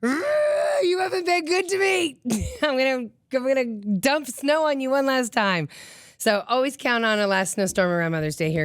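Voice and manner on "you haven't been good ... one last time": Mother Nature scolding. Pretend. Vlogger voicing Mother Nature scolding with a shout. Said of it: "Mother Nature's way of saying".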